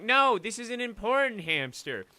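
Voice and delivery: high, loud insistent protest; loud, high-pitched indignant insistence. Retold or imitated. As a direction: plead that the hamster matters. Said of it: "I was like".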